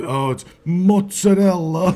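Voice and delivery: Italian mozzarella clipped as moots-a-dell; Italian-American pronunciation. Retold or imitated. Italians correcting someone, Italian-American accent. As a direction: mozzarella with a tapped r and dropped vowel, Italian style. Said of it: "some Italians will get on you if you say".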